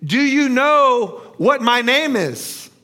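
slow, loud, high-pitched taunt; mocking. A narrator's reading. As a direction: draw the question out mockingly. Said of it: "rephrased the question mockingly".

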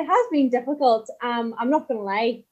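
ordinary talk

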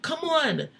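high-pitched hurry-up; re-enacted urgent call. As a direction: act out tugging someone out of danger. Retold boyfriend pulling her away.

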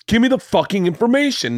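high-pitched exasperated demand; panicking. Retold or imitated. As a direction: act out mounting panic, pitch jumping up. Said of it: "Now I'm panicking".

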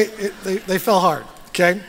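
ordinary talk, nothing marked

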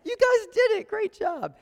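very high, loud cheer; squeaky mock praise. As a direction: congratulate them in a squeaky falsetto. Mock praise after class repeats chet.

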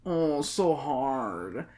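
slow, soft mock sympathy; mock pity. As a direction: drag it out in fake pity. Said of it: "even though she studied so hard".

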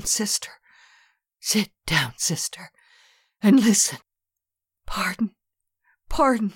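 high broken whispering plea, jerking; hoarse, jerky, tremulous. Acted. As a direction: fight for each word, trembling. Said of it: "hoarse, jerky, tremulous".

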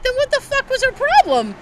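high, loud retold disbelief; incredulous outburst. Child challenging dad about his old boss, incredulous.

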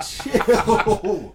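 laughing warning; laughing chill. Real, not acted. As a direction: plead while laughing. Laughing at a risky claim.

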